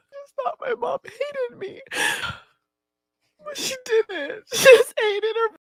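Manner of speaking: breaking down through tears; crying. Real, not acted. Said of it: "I feel like I'm mostly crying".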